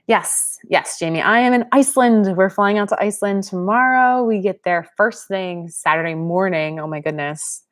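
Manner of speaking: ordinary delivery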